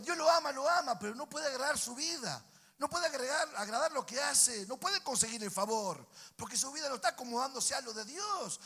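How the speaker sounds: high, impassioned sermon delivery; preaching at full force. Real, not acted.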